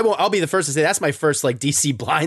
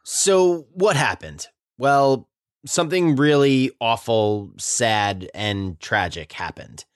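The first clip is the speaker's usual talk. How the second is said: somber scripted read; 4 semitones lower than usual. Acted. Host tells listeners why Snyder left, somber read. Reads scripted account of tragedy.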